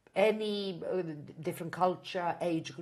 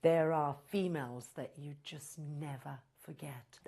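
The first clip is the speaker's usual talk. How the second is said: ordinary delivery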